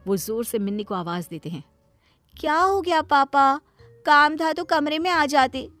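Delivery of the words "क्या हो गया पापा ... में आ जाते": put-on high, pouty teen daughter annoyed at her father; spoilt daughter. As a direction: whine it like a spoilt girl. Daughter Minni voiced by narrator. (ऊँची आवाज़ में बिगड़ैल बेटी)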